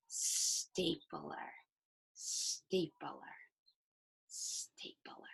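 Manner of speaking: "stapler" said slowly and softly, fading to a whisper, stretching the st; slow stretched word. Shown as a demonstration. Teacher models saying "stapler" slowly for the class. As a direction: draw out "stapler" quietly, letting the s and t be heard. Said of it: "Say the word really, really slowly".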